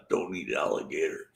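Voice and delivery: normal talking voice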